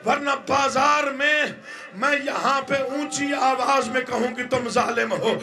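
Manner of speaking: high, crying lament with shouted lines; mourning preacher crying out the tragedy in full force; impassioned masaib recitation. Acted. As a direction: wail the mother's plea to the gathering.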